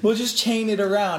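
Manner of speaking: ordinary talk, nothing marked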